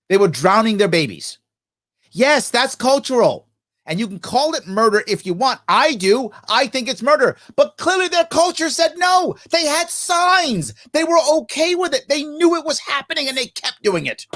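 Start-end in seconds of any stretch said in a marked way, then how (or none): 2.1-12.0 high-pitched, impassioned outburst; emotional, bothered. Real, not acted. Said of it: "So I'm emotional because I'm bothered by it". 12.1-14.3 high, strained, upset insistence; emotional, bothered. Real, not acted. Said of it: "So I'm emotional because I'm bothered by it".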